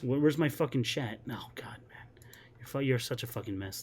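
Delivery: whispered under his breath; a muttered whisper to himself. Real, not acted. Muttering while checking chat.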